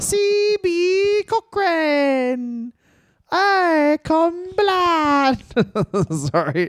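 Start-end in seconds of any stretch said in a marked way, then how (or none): 0.0-5.4 much higher, very slow crying squeak; drawn-out high mock wail; wailing mock falsetto.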